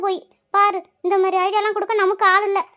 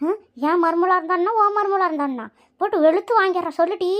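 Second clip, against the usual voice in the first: ordinary delivery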